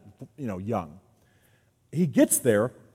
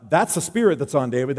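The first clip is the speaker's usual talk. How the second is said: ordinary delivery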